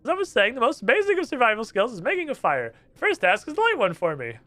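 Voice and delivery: chirpy surprised underwater stranger; squeaky NPC. Acted. Trapped islander greets the first visitor, high squeaky.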